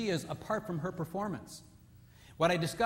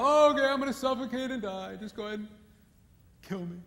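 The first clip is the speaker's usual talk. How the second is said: higher, louder put-upon sigh; mock resigned husband; 6 semitones higher, 5 dB louder than usual. Preacher mocking husband who won't suffocate. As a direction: play a husband meekly giving up.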